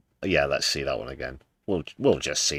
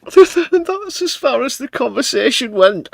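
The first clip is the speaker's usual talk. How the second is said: laughing; 8 dB louder, slower than usual. Real, not acted. Laughing while commenting.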